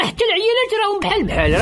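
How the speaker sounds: ordinary talk, nothing marked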